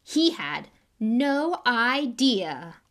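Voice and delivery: high, loud, self-delighted showman; vain celebrity. Acted. Lockhart announcing to the crowd, preening and loud.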